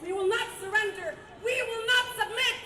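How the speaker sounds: loud defiant rally vow; roaring rallying speech. Acted. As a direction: hammer each vow to the crowd. Rally speech, repeated vows.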